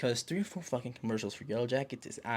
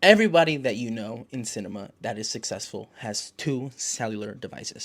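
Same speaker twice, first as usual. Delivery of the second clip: usual speaking voice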